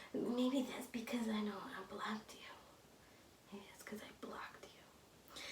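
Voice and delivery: whispered retort; whispered comeback. Retold or imitated. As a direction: breathe it out in a whisper.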